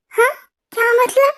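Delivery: ordinary delivery, nothing marked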